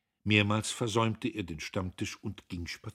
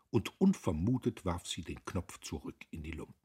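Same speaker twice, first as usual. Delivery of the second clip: ordinary delivery, nothing marked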